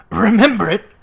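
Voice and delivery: loud, fervent outburst; fervent cry. A narrator's reading. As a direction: cry it out with fervour. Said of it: "cried Scrooge with fervour".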